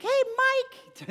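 put-on very high, squeaky young kid's voice; Mickey Mouse squeak. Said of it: "It sounded like M-, Mickey Mouse".

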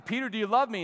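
retold question with rising urgency; pressing question. Second, more pressing question retold.

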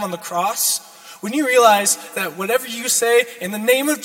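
loud, fervent preaching; preaching at full force. Acted. Sermon builds to full force.

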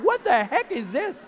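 high, loud mock-incredulous outburst; mocking squeal through laughter; imitating laughing wife. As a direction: imitate someone cracking up in disbelief. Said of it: "hands buried in her face laughing".

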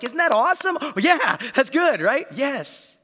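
put-on high, loud, eager cheer to the kids; excited dad. Dad trying to excite his young sons, high and loud. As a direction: sell it to the little boys. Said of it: "I'm like".

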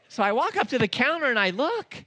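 ordinary talk

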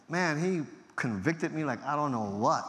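ordinary delivery

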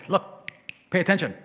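dad demanding attention; military dad command. Retold or imitated. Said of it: "he's like".

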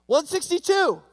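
high, loud call out the window; playful yelled number. Retold or imitated. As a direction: shout it so every cell hears. Old prisoner yells joke number.

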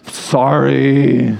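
put-on grudging kid's drawn-out sorry; mock insincere apology after a sigh; fake apology. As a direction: say sorry without meaning it. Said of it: "Sometimes it's so fake".

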